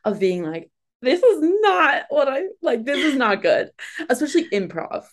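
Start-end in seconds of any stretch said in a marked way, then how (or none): 1.0-3.7 high-pitched mock panic; imagined nerves. Host imagines nerves while acting, mock panicking. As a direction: act out anxious self-doubt, pitch jumping up. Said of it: "do you get nervous for acting ... so in my head".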